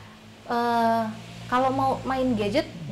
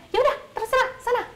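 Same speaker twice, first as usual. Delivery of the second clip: high-pitched, huffy mock dismissal; acted angry mother giving up, voice jumping up; exasperated giving in; 14 semitones higher, 3 dB louder than usual. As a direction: wave the child off in exasperation. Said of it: "marah-marah, tapi ujungnya".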